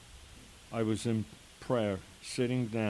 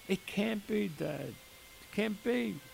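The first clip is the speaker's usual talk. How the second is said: much higher, breathless disbelief; stunned disbelief; 9 semitones higher, 4 dB quieter than usual. Retold or imitated. Daughter hearing her father recognise the man, stunned disbelief. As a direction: she can't believe what he's saying.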